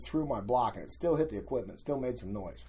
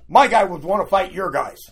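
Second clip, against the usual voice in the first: loud, high mock shout of the sensei; imitating sensei's shout; 8 semitones higher, 15 dB louder than usual. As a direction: boom the challenge out like the eager sensei. Sensei's surprise challenge, quoted.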